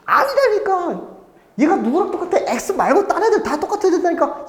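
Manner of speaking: high-pitched retold teacher pleading; exasperated correcting. Teacher retells correcting confused pupils. (답답해서 높은 톤으로 다그치는 재연)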